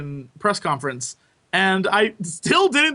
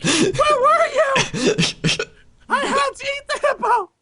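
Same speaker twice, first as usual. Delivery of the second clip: frantic high shriek. Acted. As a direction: wail like a guilty monster.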